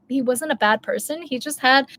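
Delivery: ordinary delivery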